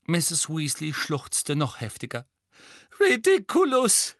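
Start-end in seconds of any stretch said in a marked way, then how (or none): 3.0-4.2 high, loud sobbed spell; sobbing. A narrator's reading. Mrs Weasley sobbing the spell again at the boggart. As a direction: sob it out desperately. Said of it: "schluchzte sie erneut".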